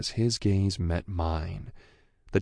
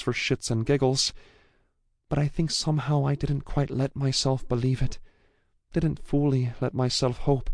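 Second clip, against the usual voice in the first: put-on higher, tearful young woman; 8 semitones higher than usual. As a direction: speak with relief, close to tears. Female character speaks emotionally.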